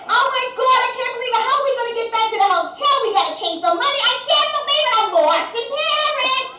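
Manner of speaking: high, loud Long Island wailing; Long Island accent. Acted. Wife lost in Paris, Long Island accent in a high wail. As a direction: panic like a shrieking Long Island tourist. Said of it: "they were talking like this".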